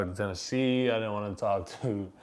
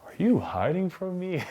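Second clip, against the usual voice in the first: higher, playful mock-wounded coaxing; teasing coach; 6 semitones higher than usual. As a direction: tease him gently for ducking you. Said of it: "he's just like".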